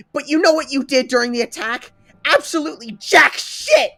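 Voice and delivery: high, loud, sustained tirade; berating the whole class in anger; furious yelling. Acted. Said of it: "Ochako yells out at everyone".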